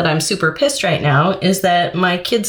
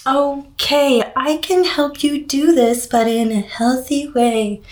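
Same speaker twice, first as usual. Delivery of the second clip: a lightly higher, sugary-sweet professional tone; mock clinician voice; 5 semitones higher than usual. Clinician placating a patient with silly diet ideas, lightly mocking. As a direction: parody a hospital clinician humouring a patient. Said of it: "you're supposed to be all".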